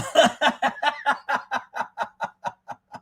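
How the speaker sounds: long, high chuckling; high cackling run; real laughter. Real, not acted. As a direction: laugh until it trails off. Laughs at picture caption.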